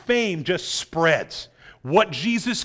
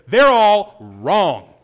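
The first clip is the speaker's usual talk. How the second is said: louder, very slow declaration; bold declaration; 8 dB louder, much slower than usual. Retold or imitated. Preacher voicing Jesus overruling the scribes, loud and slow. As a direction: plant each word firmly: they're all wrong. Jesus voiced rejecting the rabbis.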